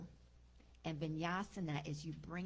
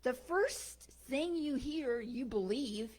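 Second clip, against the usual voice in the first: higher, emphatic telling-off; scolding; 6 semitones higher, 5 dB louder than usual. Acted. Bird tells woman she didn't learn, scolding. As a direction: drive the lesson home with raised voice.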